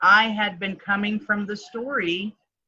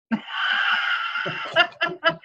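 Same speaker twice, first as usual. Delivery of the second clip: breathy high burst of laughter; real laugh; 10 semitones higher than usual. Real, not acted. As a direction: break into a high, airy laugh at yourself. Laughs at her own ego.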